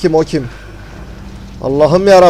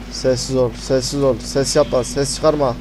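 hushed warning; 2 semitones lower, 6 dB quieter than usual. Real, not acted. Gamer hiding from the monster, telling self to stay silent, hushed warning. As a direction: keep your voice down, don't be found.

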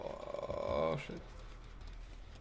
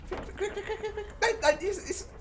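panicked. Real, not acted. Fleeing killer.

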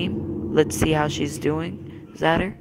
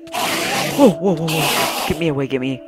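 panicked, loud and hurried; being chased; 4 dB louder than usual. Real, not acted. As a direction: flee in alarm, gasping orders. Chased, fleeing in game.

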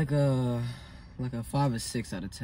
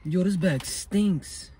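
disgusted; 6 semitones higher, 5 dB louder, much slower than usual. Real, not acted. Reacting to smelly bag.

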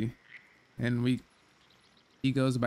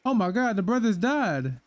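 shocked; 6 semitones higher, 3 dB louder than usual. Real, not acted. Shocked at dead brothers in game.

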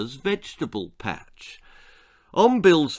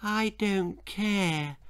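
put-on slow, soft sulky child; sulky boy; 8 semitones higher, 6 dB quieter, much slower than usual. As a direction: drag it out like a bored boy. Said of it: "said Bill".

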